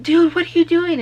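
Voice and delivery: high, loud and panicked; alarmed disbelief. Retold or imitated. Narrator to passenger flipping off cop, high alarmed yell. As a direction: yelp it at your reckless friend.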